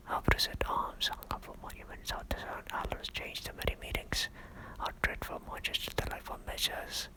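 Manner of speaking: rapid, hushed recitation; whispered soliloquy. Acted. Richard alone, recalling war turned to peace, rapidly whispered. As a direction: race through it under your breath. Repeat take, whispered fast.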